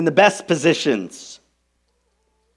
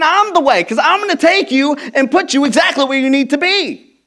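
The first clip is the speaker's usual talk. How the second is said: Jesus proclaiming; 10 semitones higher, 4 dB louder than usual. Retold or imitated. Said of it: "Jesus is saying".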